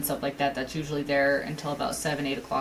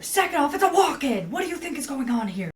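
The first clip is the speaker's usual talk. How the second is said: high, loud, indignant outburst; worked-up mock yelling; exasperated retort; 8 semitones higher, 4 dB louder than usual. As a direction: retell it as an irritated comeback, pitched way up. Retelling her angry retort.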